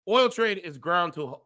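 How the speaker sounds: measured news-style reading of an article; formal reading of a grim forecast; article read aloud. Acted. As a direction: read it like a serious report.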